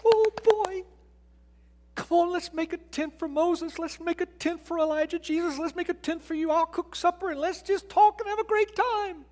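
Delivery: high, excited, rushing Peter; eager Peter. Retold or imitated. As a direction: bubble over like an overeager Peter. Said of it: "Peter ... says".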